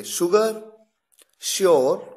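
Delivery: शुगर and श्योर both opening with sh; sh examples. Shown as a demonstration. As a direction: say them slowly with sh. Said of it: "तो देखिए".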